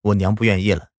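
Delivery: ordinary delivery, nothing marked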